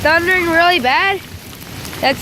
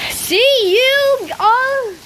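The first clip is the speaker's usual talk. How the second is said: drawn-out farewell; 10 semitones higher, much slower than usual. Acted. Drawn-out sign-off.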